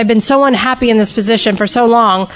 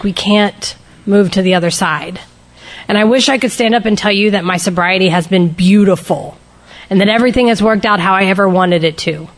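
ordinary talk

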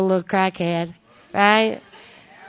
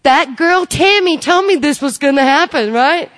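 future sponsee's voice; 6 semitones higher, 7 dB louder than usual. Pretend. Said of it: "y'all gonna say".

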